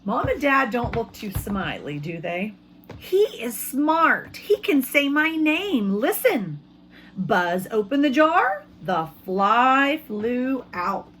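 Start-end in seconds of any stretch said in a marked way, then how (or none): 3.0-6.6 put-on higher, slow, pleading child's voice; eager boy. Teacher voicing the boy persuading his parents, eager. 7.2-11.1 storybook reading. A narrator's reading. Teacher reading to her class, dramatic slow narration.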